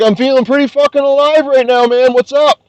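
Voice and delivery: high, mocking taunt at the rival; sing-song mock friendliness; cocky taunt. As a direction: needle him with a high, cocky greeting. Taunting the guy after the fight.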